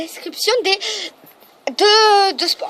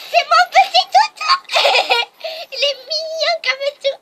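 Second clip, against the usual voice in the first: laughing at the dog; 8 semitones higher, 3 dB louder than usual. Real, not acted. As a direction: coo and laugh at the puppy.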